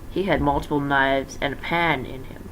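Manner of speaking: ordinary delivery, nothing marked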